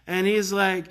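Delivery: normal talking voice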